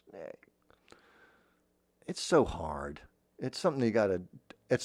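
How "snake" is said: whispered snake hiss. Shown as a demonstration. Voice teacher adding S's to a snake, whispered hiss. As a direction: hiss the word very softly. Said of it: "put some S's in there like that".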